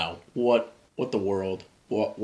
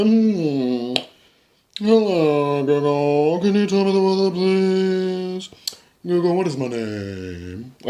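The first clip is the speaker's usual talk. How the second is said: high, loud, drawn-out silly voice; put-on home voice; 9 semitones higher, 6 dB louder, slower than usual. Shown as a demonstration. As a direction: ask the speaker a question in a hammed-up alter-ego voice. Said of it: "when I'm at home, I'll talk like this".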